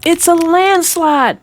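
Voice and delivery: small engine peeping in alarm; peeped in alarm. Acted. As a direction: squeak out the warning. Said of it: "Percy peeped".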